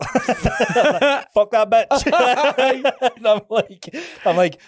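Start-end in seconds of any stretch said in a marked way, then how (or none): 0.0-3.1 squealed high through laughter, stretched out; a hammed-up, laughing curse; laughing mock rage. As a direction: cackle it out like a villain. Acting villain mindset, laughing.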